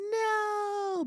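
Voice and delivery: sharp high cry of no; a soul shouting no inside; inner no. Retold or imitated. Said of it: "my spirit was saying".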